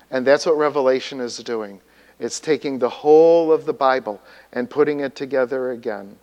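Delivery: ordinary delivery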